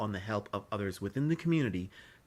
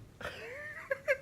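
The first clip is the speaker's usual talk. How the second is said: high embarrassed laugh; 6 dB quieter than usual. Real, not acted. Embarrassed at crying on camera.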